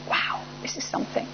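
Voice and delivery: very soft, breath-light wonder; quiet, amazed thought; hushed awe. Retold or imitated. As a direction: marvel under your breath. Awed inner thought after quiet remark.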